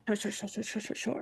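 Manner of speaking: very soft rapid murmur. Real, not acted. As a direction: mutter agreement under your breath.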